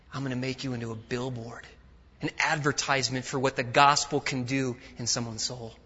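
normal talking voice

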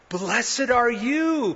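high, slow, drawn-out blessing; emphatic praise. Acted. Jesus pays Peter a compliment, emphatic praise. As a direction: stretch the words out in high praise.

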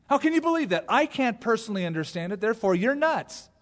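high, loud, incredulous scoff; high-pitched, indignant mock disbelief; media mockery. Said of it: "It's the voice of the media".

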